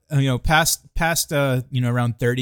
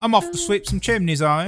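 higher, shouted mock London chimney sweep accent; 7 semitones higher than usual. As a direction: ham up a cheery London sweep.

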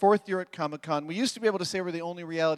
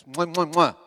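kiss sounds. Acted. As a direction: blow kisses to the crowd. Blowing kisses backstage.

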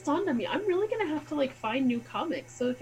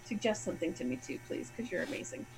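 soft pleading; 6 semitones lower, 12 dB quieter, faster than usual. Real, not acted. Asking listeners for suggestions.

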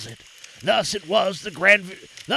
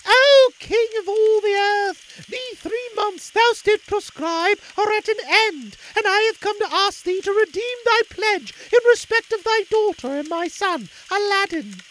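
put-on old mother voice; 6 dB louder than usual. A narrator's reading. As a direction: plead humbly before the king. Humble old mother petitions the Sultan.

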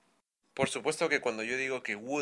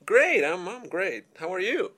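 cheerful higher guest voice; role-play guest; 6 semitones higher, 6 dB louder than usual. Acted. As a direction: answer the host brightly, voice raised.

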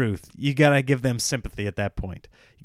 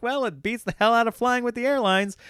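chirpy cartoon bystander, pleased with himself; high silly cartoon voice cheerfully praising the contraption; cartoon townsfolk voice; 9 semitones higher than usual. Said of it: "And they're like".

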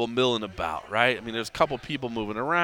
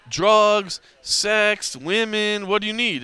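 higher-pitched imitation of a pushy vendor; pushy hustler; 7 semitones higher, 4 dB louder, slower than usual. Bar woman pressing drinkers to buy more, pushy. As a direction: list the offers one by one, high and insistent.

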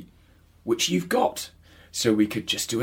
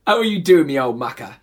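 hammed-up London Cockney voice; Cockney accent; 8 semitones higher, 6 dB louder than usual. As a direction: lay on a broad Cockney accent. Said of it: "Cockney accents for this".

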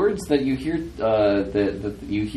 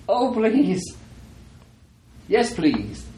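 high, pleading put-on voice; Thomas; 11 semitones higher, much slower than usual. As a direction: plead in a much higher character voice. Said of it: "You hear Thomas's voice".

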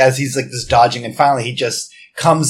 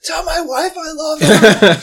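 high, slow, tearful dying plea; wounded soldier gasping. Acted. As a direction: ham up a dying soldier's last words. Dying hero melodrama at table.

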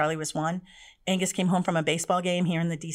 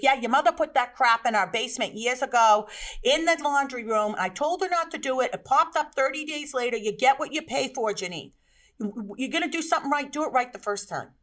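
angry father; 6 semitones higher than usual. Retold or imitated.

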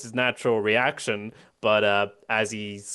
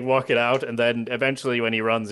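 normal talking voice

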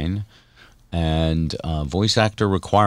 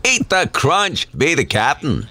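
imitating Captain Crunch; 6 semitones higher, slower than usual. Host imitates the audition.

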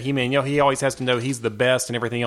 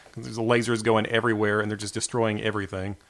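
ordinary delivery

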